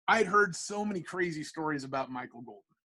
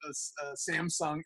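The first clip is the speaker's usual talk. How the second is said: usual speaking voice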